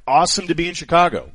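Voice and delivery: high, forced upbeat brush-off; mock cheerful. Host putting on a cheery face for management, high and forced. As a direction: fake a bright welcome through the sting. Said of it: "I was like".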